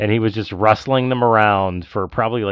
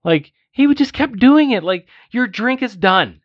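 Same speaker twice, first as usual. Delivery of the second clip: much higher and louder, exasperated outburst; heated rant; 12 semitones higher, 4 dB louder than usual. Real, not acted. Friend venting to his cohost about a slurping moviegoer, heated and much higher. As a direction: blow up at the memory of that slurping. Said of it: "I'm getting heated right now".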